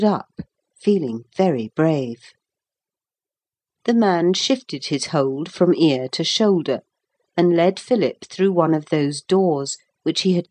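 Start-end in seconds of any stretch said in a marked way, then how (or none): none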